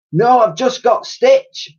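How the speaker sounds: high, slow pained croak; old soldier in pain. Acted. Titch explaining his stitch, pained. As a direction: wince through each word.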